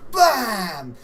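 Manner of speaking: loud, high, drawn-out bam; impact sound effect. Acted. Game master voices the headbutt, loud impact shout. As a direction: slam it out like a blow landing.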